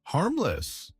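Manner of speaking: high-pitched, incredulous echo with a falling end; narrated yell of disbelief; indignant mock yell. A narrator's reading. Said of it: "he yells".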